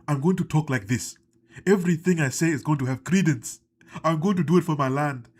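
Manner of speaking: emphatic mock African accent. Wrestler suddenly declaring his mission, in a mock African accent. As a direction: talk like a proud African war general, heavy accent. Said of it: "had an African accent one week".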